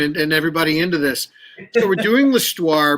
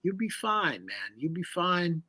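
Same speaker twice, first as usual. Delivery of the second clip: imitating easygoing reassurance; 12 dB quieter, slower than usual. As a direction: quote the teacher's soft, unbothered reassurance. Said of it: "he would just kinda laugh".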